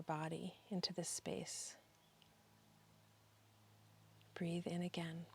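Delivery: soft, hushed meditation guide; guided breathing meditation. Real, not acted. Guide inviting listeners to breathe together, soft guided meditation. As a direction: ease them into the breath, trailing softly into long pauses.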